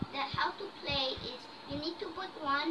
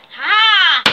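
loud sharp yelp; 3 semitones higher, 19 dB louder than usual. Real, not acted. Reacts to sour lemon juice.

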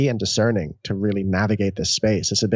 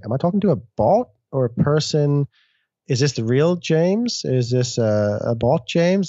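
rising, higher, puzzled questions; mock bewildered internet user; confused and suspicious; 5 semitones higher than usual. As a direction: sound unsure if you're talking to a bot. Confused user online, unsure who's real.

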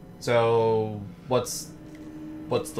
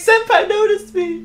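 put-on little girl voice; 4 dB louder than usual. Joins the mock girl reading.

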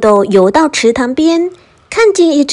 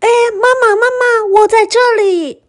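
put-on high, childlike calling voice; calling out loudly; 10 semitones higher, faster than usual. A narrator's reading. Tadpole chases a big fish, calling out. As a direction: cry out for mama in a very high pitch. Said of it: "追上去大喊".

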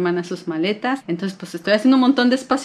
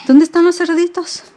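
higher, louder 'los' stressed; playful search; 10 semitones higher, 8 dB louder than usual. Acted. Mom asking baby where the piglets went, high loud. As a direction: ask it with eager wonder.

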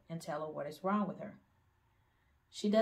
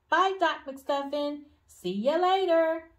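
put-on high, loud, drawn-out child's farewell; shouting goodbye; 10 semitones higher, 10 dB louder, slower than usual. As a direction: call it back down the hall as she's dragged away. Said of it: "waving and shouting".

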